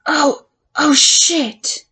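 slow, stunned, breaking cry; shocked cry. Acted. Finding the hidden camera in the office, a stunned cry. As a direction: let the horror spill out slowly.

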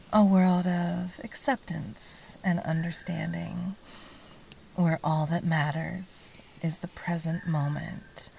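normal talking voice

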